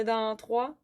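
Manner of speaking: normal talking voice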